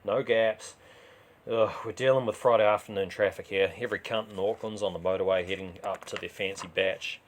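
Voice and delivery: plain reading voice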